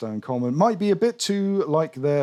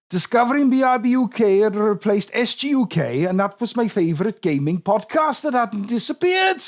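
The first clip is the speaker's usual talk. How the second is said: put-on higher, lilting Welsh accent; 8 semitones higher than usual. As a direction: read it in a sing-song Welsh accent. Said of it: "I can do a respectful Welsh accent".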